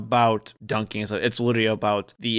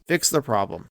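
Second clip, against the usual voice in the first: ordinary talk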